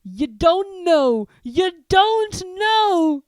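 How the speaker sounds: very slow, loud, high disbelief; dawning disbelief; bewildered Hagrid. Acted. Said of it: "fixing Harry with a bewildered stare".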